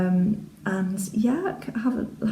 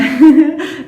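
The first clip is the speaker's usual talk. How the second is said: bright laugh; 9 semitones higher, 11 dB louder than usual. Real, not acted. Laughing at own ironing board.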